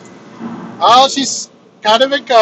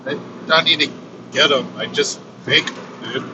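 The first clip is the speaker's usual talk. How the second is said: softer, low, offhand reply; hushed casual; 6 semitones lower, 7 dB quieter, faster than usual. Retold or imitated. The male form hushed and offhand, answering her. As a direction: shrug it off under your breath like it's nothing.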